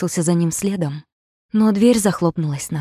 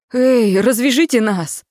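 louder, higher, slow desperate yell; 6 semitones higher, 7 dB louder, slower than usual. A narrator's reading. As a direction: bellow for help. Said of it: "заорал я не своим голосом".

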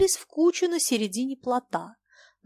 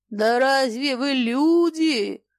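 loud, slow, irritated yell; raised, stretched cry of contempt; angry shout; 4 semitones higher, 5 dB louder, slower than usual. A narrator's reading. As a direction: shout it with spite, higher and drawn out. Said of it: "зло крикнул Фома, раздражённый криками".

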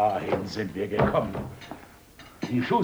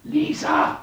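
high urgent call; sharp call; 4 dB louder than usual. Acted. Mother summoning Lisa as the teacher arrives, sharp call. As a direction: snap her name to summon her. Calling the girl.

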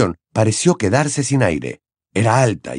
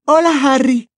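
put-on high, fed-up teenage girl; grumbling younger sister; Ginny; 4 dB louder than usual. Ginny annoyed greeting.

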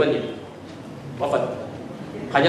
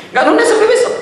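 much higher, with a laugh, nggak nunda pushed up high; emphatic repeat; 4 dB louder than usual. Shown as a demonstration. Preacher assuring the congregation Allah won't delay, delighted. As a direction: repeat it higher and gleeful, grinning through it. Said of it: "Dan Allah nggak nunda sampai besok".

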